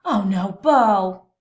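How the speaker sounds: slow, loud, exasperated mama; dismayed mother. Acted. Mama armadillo finding Beau missing, slow exasperated cry. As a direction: drag it out like a worn-out mother. Mama finds son gone.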